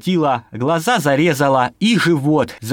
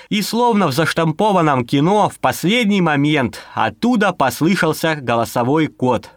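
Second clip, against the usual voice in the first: ordinary delivery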